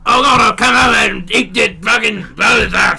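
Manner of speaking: loud, high hammed-up Cockney; mock Cockney accent. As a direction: shout it like a Cockney caricature. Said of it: "They got Cockney accents in".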